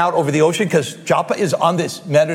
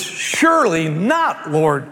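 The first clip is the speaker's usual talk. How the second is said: high, emphatic refusal; indignant no chance no way; Peter protesting; 6 semitones higher, much slower than usual. Retold or imitated. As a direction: push back hard, voice climbing. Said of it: "And Peter's response was".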